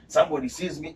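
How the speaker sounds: ordinary delivery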